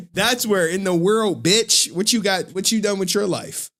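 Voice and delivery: hyped boast. Real, not acted. Streamer bragging to chat about the world ranking, hyped. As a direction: crow about it, laughing at himself. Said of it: "hyping his own third place".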